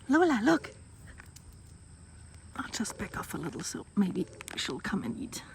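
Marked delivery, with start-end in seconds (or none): 2.5-5.4 hushed whisper so as not to scare; whisper, barely voiced. Real, not acted. Not scaring the dog.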